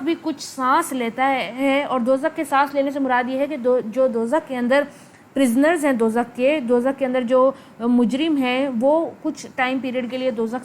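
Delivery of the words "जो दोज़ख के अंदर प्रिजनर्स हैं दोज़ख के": ordinary delivery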